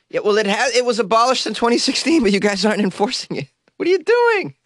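high, incredulous mock scolding; mock exasperation. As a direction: climb to a squeaky, baffled protest.

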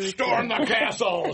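rallying the mob, laughing; playful battle cry; angry mob leader. Pretend. Villager role in Beauty and Beast.

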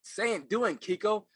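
loud, high, commanding coach voice; a coach cutting in on a student's scene, loud and high; stern acting coach. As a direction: order him to just say the lines. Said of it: "She said".

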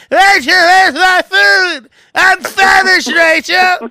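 high, loud, slow whiny shout; husband bellowing at wife for dinner, mock whiny shout; hammed-up demanding husband. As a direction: yell for your dinner like a spoiled husband. Imitating Sam demanding food.

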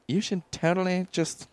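high put-on Hillary voice; a hint of a scheming politician; Hillary impression. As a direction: play a sly agent offering the deal. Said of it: "She's like".

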